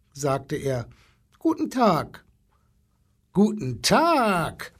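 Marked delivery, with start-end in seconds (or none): 1.4-2.2 put-on short, high greeting from a small boy; high-pitched child greeting, lightly voiced; little boy's greeting. A narrator's reading. Timid boy greets the zoo animals. 3.3-4.7 long drawn-out, raised elephant greeting; stretched-out, blustery animal hello; blustering elephant. A narrator's reading. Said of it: "polterte der Elefant".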